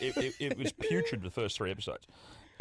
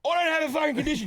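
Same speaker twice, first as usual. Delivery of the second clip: high, raised acted outburst; exasperated protest; 11 semitones higher, 4 dB louder, faster than usual. Passenger refusing priority boarding help, exasperated outburst. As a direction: blow up at being told you have a condition.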